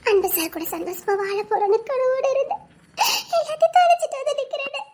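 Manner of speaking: crying through the words, pitch climbing; weeping to her friend over a broken dream; tearful lament. Acted. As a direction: sob out a life's lost dreams. Woman laments her ruined life.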